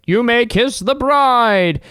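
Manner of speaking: high, loud drawn-out announcement; slow, booming mock officiant; wedding officiant. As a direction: proclaim it grandly, stretching the words.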